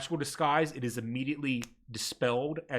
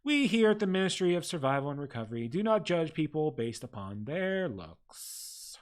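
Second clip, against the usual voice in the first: desk clerk; 2 semitones higher than usual. Acted. Ministry clerk greets the ragged party, primly bureaucratic. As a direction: recite the rules like a tired official. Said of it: "the person behind the desk looks up and says".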